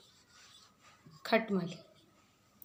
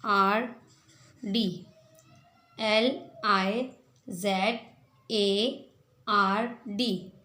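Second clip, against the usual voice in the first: slow spelling; much slower than usual. Shown as a demonstration. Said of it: "slow spelling for children".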